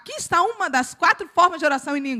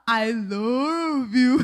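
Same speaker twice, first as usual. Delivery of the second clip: put-on slow, drawn-out accented "I love you"; American imitating Bahian; much slower than usual. American friend trying the girl's phrase, clumsy accent. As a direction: stretch it like an American attempting Bahian English. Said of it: "que fala".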